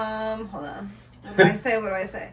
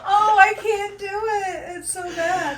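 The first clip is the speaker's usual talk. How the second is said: whiny despair; 5 semitones higher, 4 dB quieter, slower than usual. Real, not acted. Whining about failed impression.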